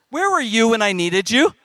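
playfully wounded outburst, pushed up at the end; mock reproach. Speaker chiding the audience for late sympathy, mock reproach.

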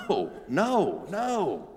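mock denial through a laugh; laughing protest. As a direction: refuse with a nervous laugh. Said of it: "he expresses, like".

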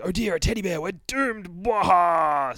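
mock the teddy with fake dread; mocking monster minions.

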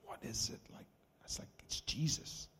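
hushed whispered exchange. Retold or imitated. Two friends whispering in the bar. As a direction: keep it secret and breathy. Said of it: "And he's like".

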